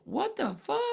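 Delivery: very high, loud mock-shocked cry; imitated shock. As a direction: imitate a group of girls aghast. Other girls' shocked reaction, imitated.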